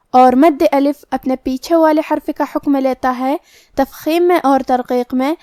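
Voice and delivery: normal talking voice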